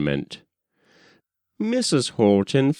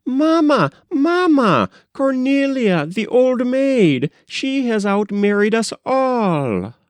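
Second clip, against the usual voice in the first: screaming; 13 semitones higher than usual. A narrator's reading. Disheveled sisters rushing in to tell Mamma, screaming high.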